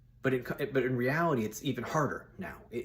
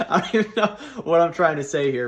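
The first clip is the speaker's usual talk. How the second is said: laughing; 7 semitones higher, 10 dB louder, faster than usual. Real, not acted. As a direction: talk through loud, high laughter. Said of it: "I'm cracking up".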